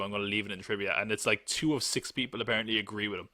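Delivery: usual speaking voice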